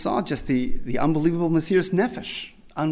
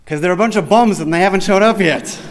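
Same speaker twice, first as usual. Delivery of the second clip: joking gruff retort; 3 semitones higher, 9 dB louder than usual. Retold or imitated. Rabbi's joking gruff answer.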